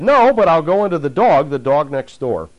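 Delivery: the spirit haggling for a way out; strained demon voice, fading at the end. Retold or imitated. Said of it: "And the voice said".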